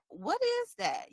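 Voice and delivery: high, rising what is that; curious surprise. Pretend. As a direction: jump up high in sudden curiosity. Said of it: "you're like".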